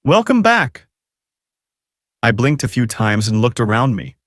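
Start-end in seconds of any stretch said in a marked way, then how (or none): 0.0-0.9 lightly voiced rogue captor, high-pitched; a hint of a smirking villain, pitch raised; rogue's voice. A narrator's reading. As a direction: welcome the captive back in a high, mocking lilt. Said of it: "I could hear the rogue's voice".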